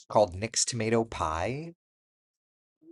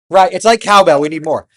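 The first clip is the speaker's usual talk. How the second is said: loud, high, hammed-up demand for more; mock cowbell shout; 9 semitones higher, 17 dB louder than usual. Telling the audience about garlic, loud mock exclaim. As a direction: belt it out like a rock fan. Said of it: "But then I'm like".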